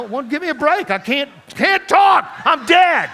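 high, loud, hammed-up protest; mock exasperated shout. Dead man answering his scolding wife, mock exasperated shouting. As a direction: playfully yell it back at full volume, stretching the words.

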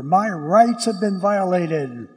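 slow, loud aggrieved protest; a hint of a whining victim pleading his case; complaining victim. Citizen protesting to a Roman emperor, slow and loud. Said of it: "and say to the Roman emperor".

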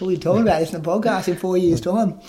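excited retelling of being thrilled; frothing with excitement. Retold or imitated. Retelling his excitement over the podcast moment, excited. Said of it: "That was frothing. I'm like".

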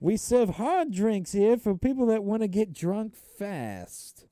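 put-on high, loud old-movie tough guy; tough guy bartender. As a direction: bark it slowly as a hammed-up barman. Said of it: "the tough guy bartender".

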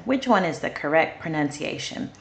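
usual speaking voice

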